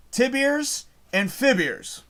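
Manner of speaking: slow drawn-out "Tibiers and Fibiers" for tibias and fibulas. Retold or imitated. Streamer quoting the movie actor's line, slow drawn-out "Tibiers and Fibiers". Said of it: "the actor says".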